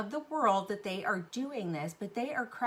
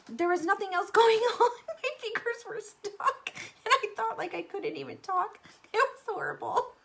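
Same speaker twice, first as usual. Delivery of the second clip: laughing; 12 semitones higher, 5 dB louder than usual. Real, not acted. Glued-finger story, laughing.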